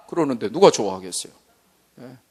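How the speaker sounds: normal talking voice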